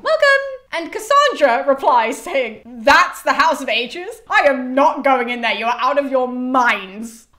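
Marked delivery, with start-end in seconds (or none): 0.0-0.4 very high, loud chirp; mock-cheery welcome. Chorus welcoming Cassandra to her new home, mock-cheery. 2.7-7.3 loud, drawn-out mock disbelief; appalled refusal. Cassandra refusing to enter the house of Atreus, appalled.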